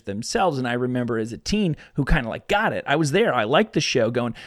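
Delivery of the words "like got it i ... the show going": ordinary talk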